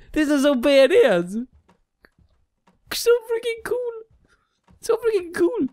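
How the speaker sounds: very high astonished voice; high thrilled squeals; amazed squealing. Real, not acted. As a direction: squeal with disbelief at the flying toaster. Toaster flies away, amazed.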